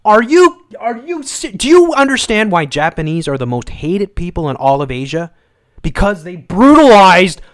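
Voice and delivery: loud, high furious tirade; re-enacting a furious outburst at a woman; angry retold rant. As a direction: yell it at her like you lost it. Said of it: "I'm like".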